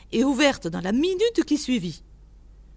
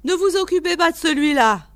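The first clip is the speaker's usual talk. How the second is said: quick, high, loud reassurance; animated old keeper; 7 semitones higher, 7 dB louder, faster than usual. A narrator's reading. Keeper tells visitor to ignore the lizard, brisk and loud. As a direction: wave away the worry briskly.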